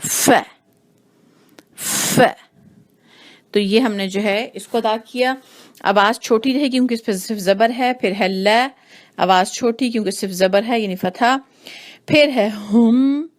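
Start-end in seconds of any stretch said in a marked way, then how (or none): 0.0-2.2 ف with a puff of breath; breathy fa. Shown as a demonstration. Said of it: "اب فا کے ساتھ کریں". 12.1-13.3 ہم drawn out, breathy ہ; slow demo. Shown as a demonstration. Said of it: "ہ بھی جو ہے وہ محنت والا حرف ہے".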